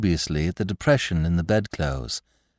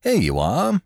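high, cheerful greeting; 10 semitones higher than usual. Acted. Lord Peter greeting Graves, high and cheerful. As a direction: sing it out cheerily. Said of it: "said that nobleman cheerfully".

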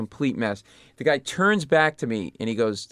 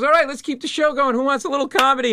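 much higher and louder emcee call; MC hype; 11 semitones higher, 5 dB louder than usual. Retold or imitated. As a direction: pump up the room like an emcee. MC rallies the crowd.

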